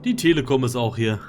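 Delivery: ordinary talk, nothing marked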